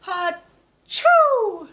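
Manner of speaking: loud, high mock sneeze; big sneeze. George the piglet sneezes in a story read to a child, loud. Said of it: "says George".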